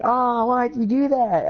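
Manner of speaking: whiny complaint. Retold or imitated. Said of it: "he's like".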